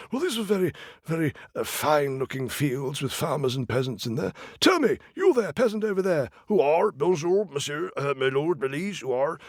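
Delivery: high royal voice, fumbling for French; king fumbling a greeting; pompous king. Acted. King asking the peasants who owns the fields, high and fumbling.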